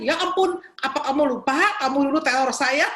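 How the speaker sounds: high-pitched incredulous inner voice; mock indignation. Preacher silently asking the caller who once terrorized her, mock indignation. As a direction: retell an exasperated, disbelieving thought.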